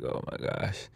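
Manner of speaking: thinking it to himself in the car; inner thought. Retold or imitated. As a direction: breathe it to yourself in awe. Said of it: "I'm thinking to myself".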